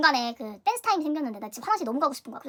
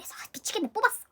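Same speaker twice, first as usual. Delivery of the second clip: ordinary talk, nothing marked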